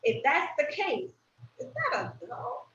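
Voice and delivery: normal talking voice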